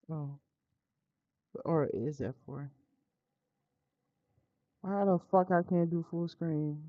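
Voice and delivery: ordinary delivery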